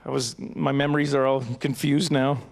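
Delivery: ordinary talk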